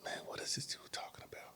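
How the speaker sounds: whispered inner thought. Retold or imitated. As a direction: whisper like your own confused thoughts. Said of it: "And my brain was like".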